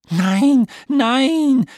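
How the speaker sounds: high, loud panicked cry; frightened cry. A narrator's reading. Urmel sees the time glider vanish, frightened high cry. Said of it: "rief jetzt das Urmel erschrocken".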